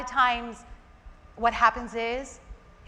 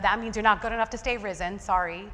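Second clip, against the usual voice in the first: ordinary talk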